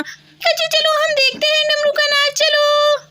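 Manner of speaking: put-on high, eager child voice; high childish voice, excited; excited little girl. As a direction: play the little daughter asking mom. Child voice again.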